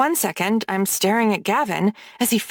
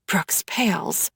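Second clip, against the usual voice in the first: ordinary delivery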